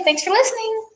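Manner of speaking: very high-pitched, drawn-up farewell; cheery sign-off. Real, not acted. Host thanking listeners before the break, cheery high sign-off. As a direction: sign off high above normal talk.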